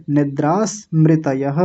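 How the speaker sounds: निद्रा स्मृतयः as "nidras-mritayah", slowly; s joined backward. Shown as a demonstration. Teacher splitting after the s, slow "nidras" in निद्रा स्मृतयः. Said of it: "निद्रा के साथ स को ले लीजिए".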